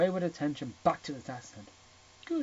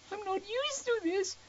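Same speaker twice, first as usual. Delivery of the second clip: put-on much higher, slow girlish voice; flustered young woman. Ana apologizing to Mister Gray, high-voiced. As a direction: play her nervous and high.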